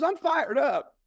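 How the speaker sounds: very high-pitched echo of a rallying cry; imitated excited child. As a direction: mimic a daughter pumping herself up. Daughter repeats the hype phrase.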